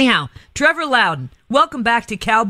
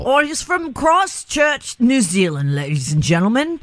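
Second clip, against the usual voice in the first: put-on New Zealand accent; 3 semitones higher than usual. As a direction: try your best New Zealand accent. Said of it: "With your best New Zealand accent possible".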